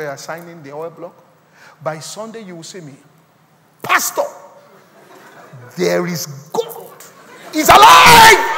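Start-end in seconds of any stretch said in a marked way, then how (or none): 3.8-8.5 acted jubilant cry, building to a high, drawn-out scream; exultant testimony shout. Church member testifies to pastor after oil deal, acted exultant shouting. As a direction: shout it slowly, climbing to full-force triumph.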